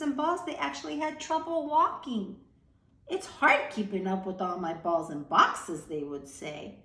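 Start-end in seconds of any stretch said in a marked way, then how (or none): 3.1-5.9 showy, louder fake complaint; mock complaining. Wemmicks bragging about their toys, mock complaining. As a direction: brag while pretending to whine. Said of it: "acting like they were complaining".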